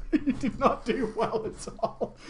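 real laugh breaking into the words; amused, laughing delivery; laughing while talking. Real, not acted. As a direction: say it through helpless laughter. Laughing at the story's character.

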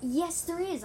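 high, loud contradiction; arguing. A narrator's reading. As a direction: insist right back. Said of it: "I argued".

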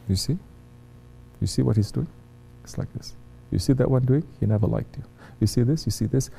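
hushed, secretive whispering in the ear; shaitan whispering. Retold or imitated. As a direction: whisper it like a tempter sowing doubt. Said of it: "Starts whispering".

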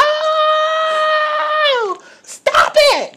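loud, very high mock wail; imitated hollering. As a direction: holler as the tased woman, half laughing. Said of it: "And she hollered".

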